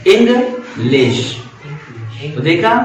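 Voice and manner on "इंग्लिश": "इंग्लिश" split, इंग stable, लिश lifted a little; two-part "इंग्लिश" with a pause between; steady, then ascending. Shown as a demonstration. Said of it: "लिश थोड़ा सा ascending है".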